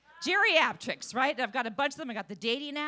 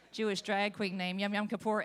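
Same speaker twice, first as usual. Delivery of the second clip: ordinary talk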